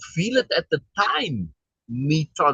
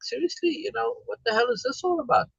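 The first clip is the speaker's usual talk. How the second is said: scolding back; 10 semitones higher, 4 dB quieter than usual. Retold or imitated. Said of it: "scolded her back".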